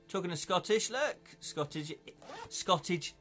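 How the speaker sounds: ordinary delivery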